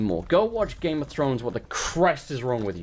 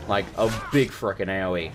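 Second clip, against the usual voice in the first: normal talking voice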